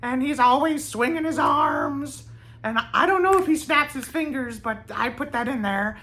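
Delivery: very high-pitched character voice; high voice of an odd, happy character; Bobby Justin impression. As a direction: put on a high, loud eccentric voice. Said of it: "I have to do a Bobby Justin impression for you".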